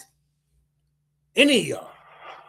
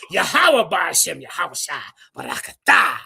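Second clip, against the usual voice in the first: bursts of loud mock Hebrew; hammed-up pretend Hebrew; mock gibberish Hebrew; 4 dB louder than usual. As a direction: chant fake Hebrew with punched loud endings. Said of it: "I speak gibberish Hebrew too".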